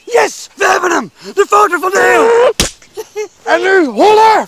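high, jubilant cheer; triumphant shout. Real, not acted. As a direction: crow over the scoop. Paparazzo gets the shot.

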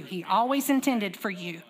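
slow, deliberate repeat. Shown as a demonstration. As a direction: drag out "to turn in the shade" slowly. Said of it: "one more time too".

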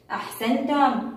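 normal talking voice

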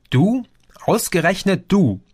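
high-pitched, astonished disbelief; incredulous. A narrator's reading. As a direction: react as if you can't believe it's him. Friend hears about the brawl.

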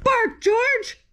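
a bright high call of bark George; commanding mother. Acted. Mother dog ordering George to bark, calling out.

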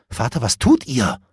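a touch of shock; startled cry. A narrator's reading. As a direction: call out in sudden dismay. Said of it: "rief Arthur erschrocken".

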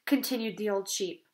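plain reading voice

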